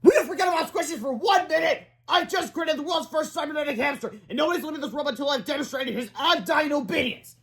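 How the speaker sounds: high-pitched, worked-up rant; exasperated screaming boy. A narrator's reading. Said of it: "screamed Melvin".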